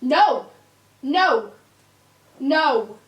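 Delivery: loud repeated firm no. Real, not acted. Owner repeating firm no to puppy. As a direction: hold the line.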